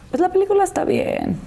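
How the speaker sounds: high, light mock voice; mock lukewarm critic. Ex-husband lightly giving his opinion of her movie, mock high voice. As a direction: play the ex-husband judging her film.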